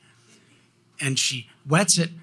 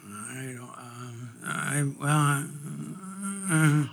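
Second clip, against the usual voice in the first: hesitant, halting mock stammer; stammering excuse; slower than usual. Boy answering his teacher about the missing assignment, stammering. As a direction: act out a child lost for an answer.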